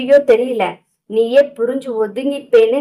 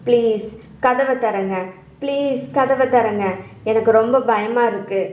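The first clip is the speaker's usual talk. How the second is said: slow, softer wailing plea stretched like a tune; drawn-out singsong plea; 4 dB quieter than usual. Acted. Priya calling through the door all night, slow and drawn out. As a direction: draw each word out like a lament. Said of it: "சத்தம் ராகம் போல் இழுத்து".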